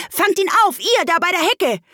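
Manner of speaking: high, loud rabbit call. Acted. The rabbit calls to the helpers, high and loud. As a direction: shout it out as the rabbit. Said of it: "die Stimme des Kaninchens".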